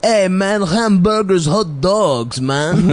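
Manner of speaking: put-on Greek cousin faking an American accent; mock foreigner's attempt at an American accent; bad American accent. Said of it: "trying to do an American accent".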